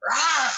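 mock dinosaur roar, high and loud. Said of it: "you hear this loud sound".

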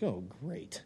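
very quiet, deflated aside; soft mock dismay; reluctant resignation. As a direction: mutter it under your breath, unenthused. Reluctant reply to God, retold.

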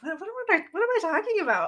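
high, fast, sudden realisation; startled self-doubt. Retold or imitated. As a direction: snap out of a daydream in disbelief.

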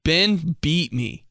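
slow, dragged-out teasing delivery; drawn-out tease. Retold or imitated. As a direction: drag it out to build suspense. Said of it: "the biggest tease of all time".

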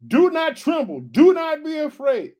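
booming preacher reading, high and loud; preaching scripture. Acted. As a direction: proclaim it like a preacher.

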